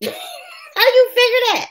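slow, high, laughing question; laughing disbelief. Real, not acted. Repeating her question to a viewer, laughing incredulously.

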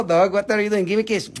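ordinary talk

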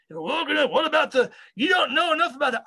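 high-pitched mock squabbling; retold agitated protest; arguing. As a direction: talk over someone in an imitated argument. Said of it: "they were having an argument".

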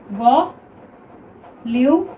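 Volume stretched very slowly; slow drawn-out reading. Shown as a demonstration. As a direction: read volume slowly, syllable by syllable. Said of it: "देखिए पहली है volume". (Volume को धीरे खींचकर)

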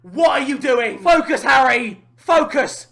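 normal talking voice

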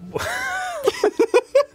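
high shrieking burst of laughter. Real, not acted. Laughing at own joke.

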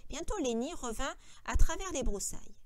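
usual speaking voice

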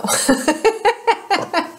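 short amused laugh, high; light laugh. Real, not acted. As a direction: let out a little laugh. Laughs after joke.

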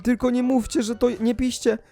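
high, insistent protest; pleading innocence. Acted. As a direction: plead that you're not the culprit. Protests his innocence to accusing friends.

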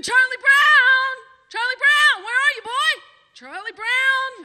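loud, high calling out across the land; screaming for the horse. Retold or imitated. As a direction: yell the name as if the horse is far off. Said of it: "I started screaming".

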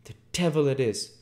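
high, loud, startled exclamation; doctor's cry. Acted. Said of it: "cried the doctor".

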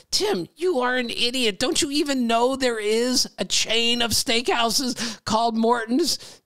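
high mocking voice of a know-it-all; snide Twitter guy. Listener mocking the host on Twitter, high sneer. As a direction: sneer it like a smug internet critic. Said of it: "some guy writes in, he goes".

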